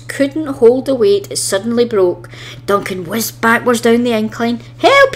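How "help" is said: very high, loud yelp for help; a frightened squeal. A narrator's reading. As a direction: engine hurtling backwards, yelping for help. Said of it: "he yelped".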